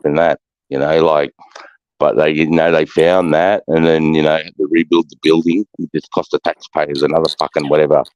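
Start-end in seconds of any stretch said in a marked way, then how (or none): none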